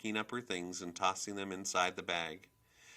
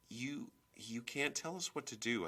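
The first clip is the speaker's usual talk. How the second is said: timid defiance; 5 semitones higher, 3 dB quieter than usual. A narrator's reading. Timid girl stands up to mother.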